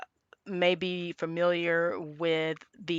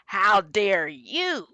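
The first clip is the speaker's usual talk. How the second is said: drawn-out outrage, building higher and louder; slow, hammed-up back-and-forth indignation; mock indignant squabble; 3 semitones higher, 4 dB louder, slower than usual. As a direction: imitate a couple trading indignant retorts. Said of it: "they just go back and forth".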